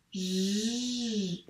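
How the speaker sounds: J as a very long "zh"; isolated J sound. Shown as a demonstration. Teacher sounding it while the pupil writes, drawn out. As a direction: hold the buzzing consonant J. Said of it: "Donc, on écrit".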